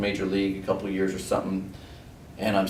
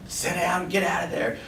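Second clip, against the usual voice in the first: jeering in a raised, strained voice; imitated heckling; 9 semitones higher than usual. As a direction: mimic your buddies heckling from the dugout. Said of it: "hackling on you, just telling you".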